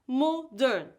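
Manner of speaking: MODERN in two distinct syllables. Shown as a demonstration. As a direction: say Modern carefully, both syllables separated. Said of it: "Say each word with the correct number of syllables".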